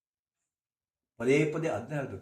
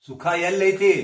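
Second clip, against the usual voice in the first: louder, ringing demand; loud question; 4 semitones higher, 11 dB louder, slower than usual. Acted. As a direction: shout the question to the crowd. Preacher shouts question again. (ಜೋರಾಗಿ ಕೂಗಿ ಕೇಳುವ ಪ್ರಶ್ನೆ)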